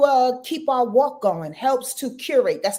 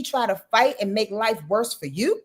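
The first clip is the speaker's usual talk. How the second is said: ordinary talk, nothing marked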